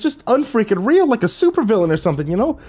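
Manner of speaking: high-pitched excited cameraman; excited rambling. A narrator's reading. Excited cameraman rambles about the creature.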